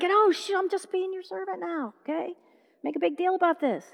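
soft, high-pitched, squirming plea; embarrassed protest. Acted. Son begging to be just a servant, embarrassed.

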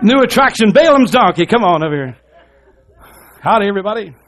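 loud, high showman's announcement; sideshow barker. Pretend. Showman presenting the talking donkey, hammed-up loud barker call. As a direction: hawk it to the crowd as a mock fairground attraction.

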